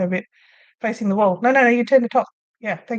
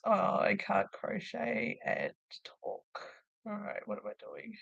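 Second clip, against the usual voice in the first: distracted murmur; 14 dB quieter than usual. Real, not acted. Losing track while crocheting.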